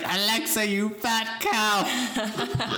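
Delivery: high, drawn-out mock yell in a Scottish accent. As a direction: yell at Alexa like an exasperated Scot, half laughing. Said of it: "Scottish person yelling at Alexa".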